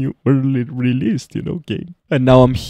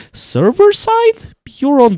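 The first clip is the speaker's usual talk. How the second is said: high squeal; 8 dB louder, slower than usual. Real, not acted. Hyping up the game.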